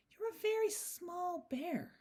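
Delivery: high, slow lady's voice; kindly lady. A narrator's reading. Mrs Brown talking to the small bear, kindly. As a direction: speak high and slowly, as to a little one.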